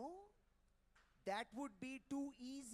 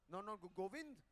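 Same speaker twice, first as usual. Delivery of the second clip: normal talking voice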